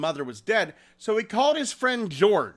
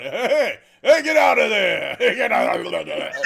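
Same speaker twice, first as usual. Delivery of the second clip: laughing mock shout that sinks into a growl; Hoover; 3 semitones higher, 5 dB louder than usual. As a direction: bark orders like a blathering seal. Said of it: "him floating in the aquarium going".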